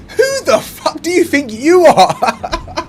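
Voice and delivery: high, loud, slow, disbelieving laugh-shout; laughing outrage. Real, not acted. Vlogger yelling at the man in the clip, laughing outrage. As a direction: shout it at the screen through a laugh.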